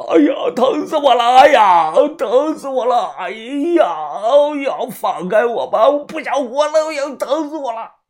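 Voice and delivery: high, loud wails of unbearable pain; agonized screaming. Acted. Patient held down in a sickroom, screaming in agony. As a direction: cry out as if torn apart by pain. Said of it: "撕心裂肺般的惨叫".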